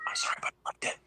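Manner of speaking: hushed confession; whispering. Real, not acted. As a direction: whisper it like a secret. Confessing an unpopular opinion.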